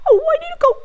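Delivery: high, whimpering plea for a coat; hammed-up cry as the sprout; mock crying. Teacher's sprout begs for a coat in mock tears.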